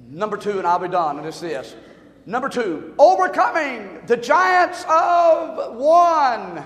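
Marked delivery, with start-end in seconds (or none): 3.0-6.6 preaching shout. Real, not acted. Preaching point proclaimed.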